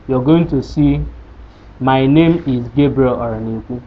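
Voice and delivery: ordinary talk, nothing marked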